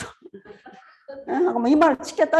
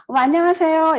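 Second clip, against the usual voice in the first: bright greeting; 6 semitones higher, 4 dB louder than usual. Shown as a demonstration. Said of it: "이러면 뭐".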